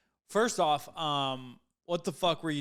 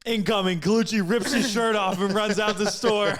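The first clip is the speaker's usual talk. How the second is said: mock action call; 7 semitones higher, 7 dB louder than usual. Joking mock action narration.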